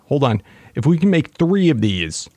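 normal talking voice

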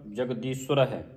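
ਜਗਦੀਸ਼ਵਰ said as jagdisura; correct pronunciation. Shown as a demonstration. Teacher models ਜਗਦੀਸ਼ਵਰ pronounced tightly. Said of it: "ਅਧਿਕ ਲਾ ਕੇ ਉਚਾਰਨ ਕਰਨਾ ਹੈ".

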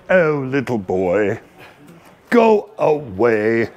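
low, slow unimpressed deer voice; bored deer. Retold or imitated. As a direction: brush off the kid like a lazy giant. The deer answers the boy.

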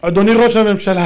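loud, ceremonial call to the chamber; Knesset podium address. Acted. Speaker at the Knesset podium addresses the leader, grandly proclaiming. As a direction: announce the title grandly and loudly. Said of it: "עומד על דוכן הכנסת".

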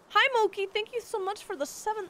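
high, excited thank-you voice; lifted, cheery streamer greeting; bright greeting. Real, not acted.

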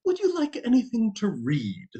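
very high-pitched maiden aunt; a high, polite offer from an aunt; aunt's voice. A narrator's reading. Jim's aunt to the three firemen, high aunt's voice. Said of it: "and she said".

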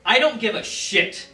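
angry shout. Acted. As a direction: yell it in disgust. Ranting at imagined student.

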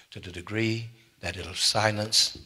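usual speaking voice

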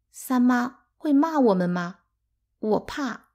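high, anxious little question; small worried voice; scared child. A narrator's reading.

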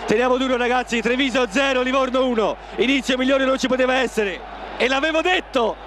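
excited goal celebration. Real, not acted. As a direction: cheer the goal to the listeners. (commento euforico dopo il gol)

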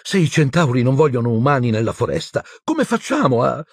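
high-pitched anxious worrying; breathless Hermione. A narrator's reading. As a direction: fret out of breath, pitch climbing. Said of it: "balbettò Hermione senza fiato". (ansiosa, senza fiato, acuta)

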